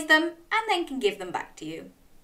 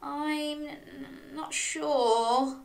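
soft, faltering doubt; hesitant; 4 semitones higher, 6 dB quieter, much slower than usual. A narrator's reading. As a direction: stall on the words as an unsure dog. Dog hesitates over the trip.

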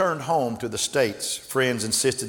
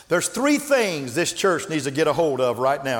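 ordinary delivery

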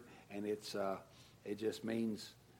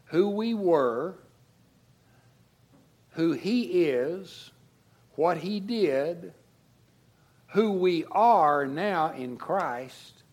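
louder, slow and emphatic, phrase by phrase; building preaching climax; 5 semitones higher, 7 dB louder, slower than usual. Acted. Pastor lifting the congregation toward rejoicing, slow emphatic build. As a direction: lift each line higher toward the climax.

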